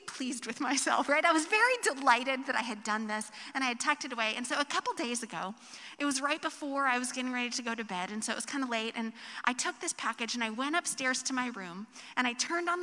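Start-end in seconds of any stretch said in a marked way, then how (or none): none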